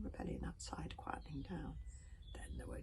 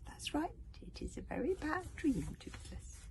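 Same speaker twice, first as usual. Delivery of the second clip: soothing, higher breathy voice; hypnotic old dragon; 10 semitones higher, 6 dB louder than usual. A narrator's reading. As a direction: lull the little one gently. Wodensfang hypnotizes Toothless.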